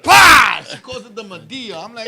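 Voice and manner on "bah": sudden loud "bah"; imitated impact. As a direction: burst it out like a blow. Imitates the hit of the Medea.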